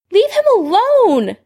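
high, urgent yell; high-pitched shouted demand. A narrator's reading. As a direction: yell it high to stop a bully. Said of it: "Lily yelled".

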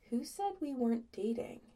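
soft, careful question; quiet, measured. A narrator's reading. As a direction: ask it softly and evenly. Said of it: "weirdly quiet and measured".

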